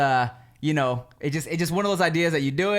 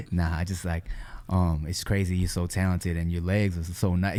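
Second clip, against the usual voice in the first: smooth flirty DJ; 7 semitones lower than usual. Retold or imitated. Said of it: "he said something about".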